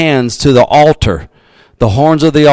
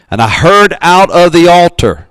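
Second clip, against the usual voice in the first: raised, ringing Bible reading; scripture reading; 5 semitones higher, 3 dB louder than usual. Acted. Pastor reading Revelation to the congregation, ringing proclamation. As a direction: lift the verse up like a proclamation.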